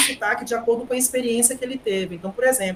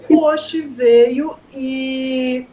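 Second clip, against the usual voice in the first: slow, louder, stretched O Oxi veio; drawn-out announcement; 3 semitones higher, 8 dB louder, much slower than usual. Retold or imitated. Teacher replaying the Oxi moment, slow and louder. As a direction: stretch every word for effect. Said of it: "repeating the Oxi line".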